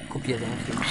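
ordinary talk, nothing marked

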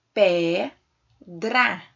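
"Pedra" split into a long pe and a loud dra; sílaba por sílaba. Shown as a demonstration. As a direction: leave a clear gap between pe and dra in "Pedra". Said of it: "basta separar em sílabas". ("Pedra" separada em pe e dra)

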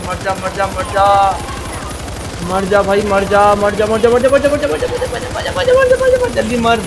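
building from loud chant to shrill high screams; frantic shout. Real, not acted. Gamer screaming at an enemy to die, rising to a high frantic yell.

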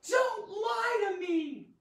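slow, high-pitched shout; screamed. Acted. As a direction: scream it slowly at the liar. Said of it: "She looked at me and screamed".

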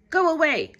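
loud grouchy shout. A narrator's reading. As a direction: yell it at the rival bug. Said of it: "shouted the grouchy ladybug".

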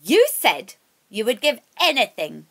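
witch voice building to a loud, menacing demand; furious witch. Acted.

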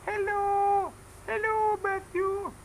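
put-on very high, slow, sing-song old lady voice; Grandma's voice. Dad voicing Grandma waving to baby Matthew, high and slow. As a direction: put on a tiny high grandma voice and stretch each hello. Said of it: "She's saying".

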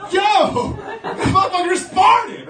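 high loud mocking yell from a classmate; kid yelling. Classmate announcing the fart to everyone, high mocking yell. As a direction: shout it across the room, gleeful.

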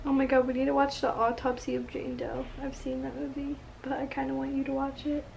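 hushed, whispered aside; whispering. Real, not acted. Talking quietly in bed at night.

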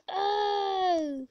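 long, high, loud oh; pained cry. Real, not acted. Gamer struggling to get on, loud high cry. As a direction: cry out loudly at the mishap. Mishap in game.

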